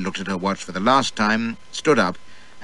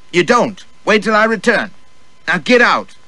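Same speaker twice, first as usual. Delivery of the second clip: sharp dismissal; 10 semitones higher, 5 dB louder than usual. Acted. Mistress dismisses servant sharply.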